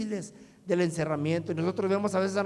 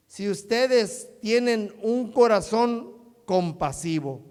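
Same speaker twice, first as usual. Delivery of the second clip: loud, slow proclamation in measured phrases; solemn public Bible reading; scripture reading; 3 semitones higher, 5 dB louder, slower than usual. Acted. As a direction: proclaim each phrase to the congregation, pausing between. Reads scripture aloud to congregation.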